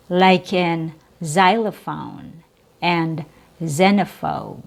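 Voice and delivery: normal talking voice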